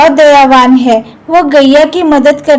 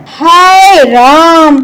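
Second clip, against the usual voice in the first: dramatic sigh; much slower than usual. Acted. Mutki sighs at her sisters.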